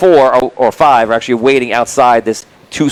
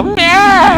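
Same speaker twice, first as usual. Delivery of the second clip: high squeaky goblin meow, much higher; 4 dB louder than usual. Retold or imitated. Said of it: "the ones around him just go".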